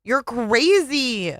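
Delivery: husband yelling in the car; yelled. Acted. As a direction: drag out the insult. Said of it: "he yelled again".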